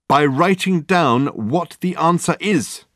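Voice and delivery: high, loud, slow proclamation; drawn-out theatrical exclamation; exclaiming. A narrator's reading. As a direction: announce it triumphantly. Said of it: "exclaimed Dirk".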